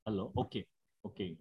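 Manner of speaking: faint voice on the line; fading out over the call; barely audible. Real, not acted. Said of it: "but your voice is very faint".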